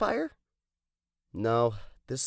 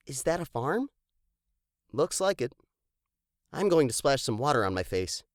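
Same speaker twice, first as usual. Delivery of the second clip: normal talking voice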